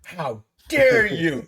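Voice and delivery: slow, loud mock indignation; imitated shocked outrage. Other actors reacting to the joke about the captain, mock outrage. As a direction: draw it out high and loud, scandalised. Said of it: "the other actors went like this".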